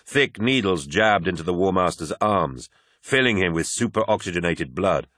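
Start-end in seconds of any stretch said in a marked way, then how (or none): none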